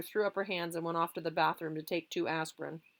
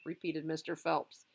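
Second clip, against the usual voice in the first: usual speaking voice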